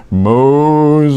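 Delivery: long, drawn-out mysterious call; voice from the bush. Acted. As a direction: stretch the name out like a divine summons. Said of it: "I heard this voice from inside the bush".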